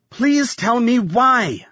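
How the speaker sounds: high, slow, drawn-out pleading; desperate plea. A narrator's reading. Jolene begs the dying attacker, desperate plea.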